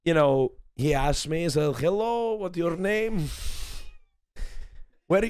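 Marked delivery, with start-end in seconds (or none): 1.8-5.3 put-on Ukrainian accent. Retelling how the man at the club introduced himself, attempted Ukrainian accent. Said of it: "I was trying my best accent".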